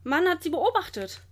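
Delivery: high, emphatic accusation; calling out. A narrator's reading. Keeper backs up his charge against the culprits, calling out. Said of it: "ruft einer der Wärter".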